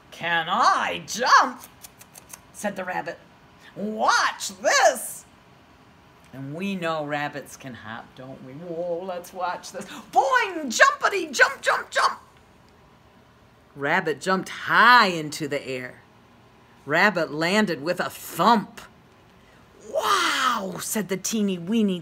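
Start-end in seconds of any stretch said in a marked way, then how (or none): 0.1-1.6 slow, scoffing rabbit. Acted. 3.7-5.2 slow, scoffing rabbit. Acted. Rabbit speaks. 10.1-12.2 high, lively jumping chant; bouncy sound effect. Acted. Reads bouncy sound words. 19.9-20.8 high, wide-eyed wow; amazed tadpole. Acted.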